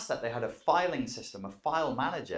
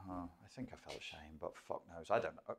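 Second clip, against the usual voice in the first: muttered; 6 semitones lower, 13 dB quieter than usual. Acted. Muttered guess at own feeling.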